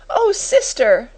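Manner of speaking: high, slow, distressed cry; tearful sniffling. A narrator's reading. Timid sister appealing to the schoolmistress, tearful. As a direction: wail it weakly, near tears. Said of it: "she sniffed".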